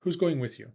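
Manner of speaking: WH-question that falls on "you"; falling question intonation. Shown as a demonstration. Lecturer giving a WH-question example, falling intonation. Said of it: "you could fall at the end".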